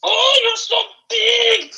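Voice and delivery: very high, drawn-out falsetto; hammed-up slow falsetto gushing; Miss Piggy. Pig gushes over the hound, hammed-up slow falsetto. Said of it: "have you seen Miss Piggy".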